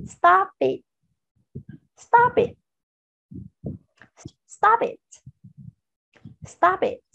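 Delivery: "Stop it" as one linked word, pitched high; linked-sound drill. Shown as a demonstration. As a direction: model "Stop it" for repeating, p joined onto "it", high and separated by pauses. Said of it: "Và khi chúng ta nối âm".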